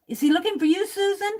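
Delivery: playfully horrified, rushing it out; mock alarm. Host teasing Susan about the man with the scar, mock alarm.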